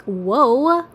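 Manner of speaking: loud, high mock surprise; a big retold double take; whoa. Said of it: "I'm like".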